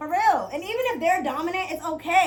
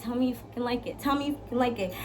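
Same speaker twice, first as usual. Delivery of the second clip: hushed demanding; 4 semitones lower, 8 dB quieter, faster than usual. Retold or imitated. Quoting her own dominant demand.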